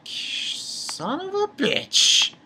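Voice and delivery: drawn-out groan. Pretend. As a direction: draw it out in amazed frustration. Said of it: "And I was like".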